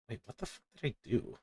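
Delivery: normal talking voice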